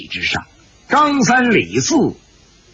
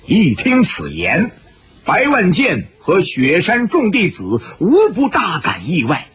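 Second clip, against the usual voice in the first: plain reading voice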